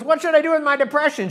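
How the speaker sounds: high loud pleading; hammed-up desperate kid asking for help; whiny Charlie Brown. Charlie Brown begging Lucy the psychiatrist, high loud whine.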